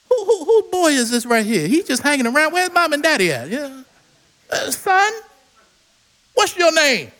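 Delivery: put-on a touch of the suspicious old teachers; doubting elders. Temple teachers quizzing the boy, mildly mocking.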